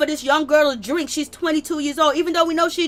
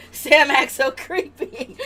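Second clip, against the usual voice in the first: laughing; slower than usual. Real, not acted. Laughing remark by other voice.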